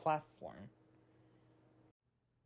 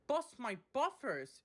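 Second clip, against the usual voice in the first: exclaiming; 8 semitones higher than usual. Acted. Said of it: "exclaimed Percy".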